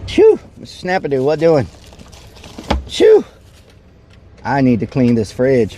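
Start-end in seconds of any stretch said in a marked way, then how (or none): 0.1-0.4 sharp high sneeze; a quick real sneeze. Real, not acted. Sneezing repeatedly. 2.9-3.2 sharp high sneeze; a quick real sneeze. Real, not acted. Sneezing repeatedly.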